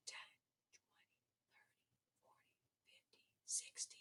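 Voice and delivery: hushed counting under the breath; teacher counting by tens in a whisper. Real, not acted. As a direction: whisper it so Yoda isn't startled. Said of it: "I got a little excited".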